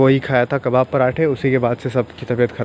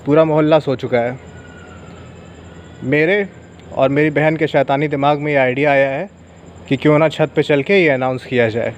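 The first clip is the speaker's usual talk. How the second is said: announcer voice; 2 semitones higher than usual. Acted. Mock announcer intro on the roof.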